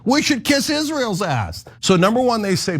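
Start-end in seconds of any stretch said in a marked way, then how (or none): 0.1-1.7 mock senior citizen. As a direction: play a gullible cable-news grandparent. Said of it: "And they're like".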